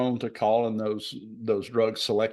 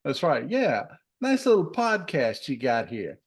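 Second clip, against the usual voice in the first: lightly voiced mob enforcer, quick; a hint of a shakedown gangster; mobster threat; 4 semitones higher than usual. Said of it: "Guido will be coming to see if you don't pay".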